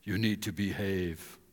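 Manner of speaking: low, slow voice from heaven; God. Retold or imitated. Voice from heaven correcting the pastor, low and stern. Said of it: "the voice from heaven say".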